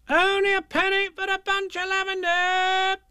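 high, loud seller's cry; market seller call. Acted. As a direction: call out your wares across the square.

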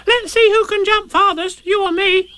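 tiny, very high squeaky youngster; high-pitched eager little voice; Roo's voice. Acted. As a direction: squeak the question like a curious little one. Roo challenges Tigger.

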